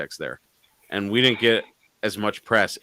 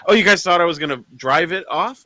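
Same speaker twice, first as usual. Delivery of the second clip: high, louder mock-innocent question; feigned surprise; 9 semitones higher, 7 dB louder than usual. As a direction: act amazed that anyone expected that. Feigns surprise at onlookers' expectation.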